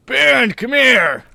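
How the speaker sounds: loud, high, drawn-out call; yell. Acted. Husband calling his wife to the phone, yelling. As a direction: yell for someone further off, stretching the words. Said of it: "then yells".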